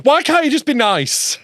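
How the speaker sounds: high, drawn-out whine; put-out, whiny appeal; wounded plea. Real, not acted. Teased, pleads with friends.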